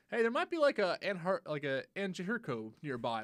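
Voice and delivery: high, bright mock notification voice; chirpy game announcer. Mimicking the game's pop-up warning, high chirpy voice. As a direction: voice the game's cheerful alert.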